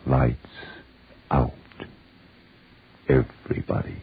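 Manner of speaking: hushed, breathless ghostly whisper; eerie whisper. Acted. Signature opening repeated.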